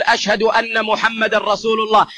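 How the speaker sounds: ordinary talk, nothing marked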